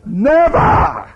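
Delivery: high, loud never with a rise; outraged cry. Acted. Peter refusing Jesus, high loud cry. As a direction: throw it out in protest.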